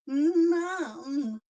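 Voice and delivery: dog calling out. Retold or imitated. Dog calling mum, voiced.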